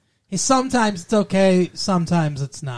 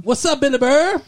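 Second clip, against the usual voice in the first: high, loud Boston-style hello; mock Boston greeting; 10 semitones higher, 4 dB louder than usual. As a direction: call out to him like a Boston buddy. Greeting the comedian in an accent.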